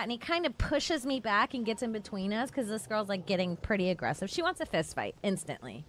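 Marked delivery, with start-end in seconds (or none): none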